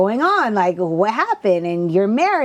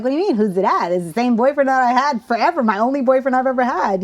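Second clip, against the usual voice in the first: higher, fast, protesting retort; retold indignation, pitched up and hurried; indignant defense; 6 semitones higher, 4 dB louder, faster than usual. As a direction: defend yourself in a rush, affronted. Retold indignant answer to dad.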